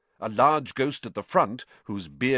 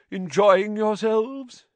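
high, drawn-out host's query; slow, lilting party-host greeting; genial ghost host; 12 semitones higher, 4 dB louder, slower than usual. Acted. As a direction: ask your guests grandly, voice lifted. Ghost host greets his guests.

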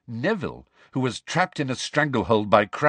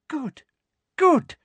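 very high, sugary approval; a pleased tyrant purring; sweet satisfaction; 4 dB louder than usual. Acted. Umbridge pleased.

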